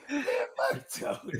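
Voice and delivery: high squeaky mock wail; exaggerated crying drop voice; imitating the drop. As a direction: parrot the silly audio drop, way up high. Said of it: "that shit is a stupid drop".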